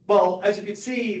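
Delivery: ordinary talk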